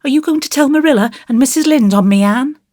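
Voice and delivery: put-on high, fast anxious little boy; a worried child, rushing; small boy voice. A narrator's reading. Davy voiced in story.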